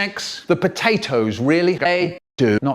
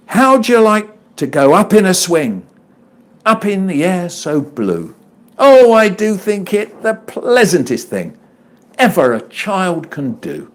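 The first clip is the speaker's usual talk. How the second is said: put-on high, louder reading of a children's poem, slowing at the close; poem recital; 5 semitones higher, 6 dB louder than usual. Reading The Swing aloud for the audience, recital voice. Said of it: "willing to perform The Swing".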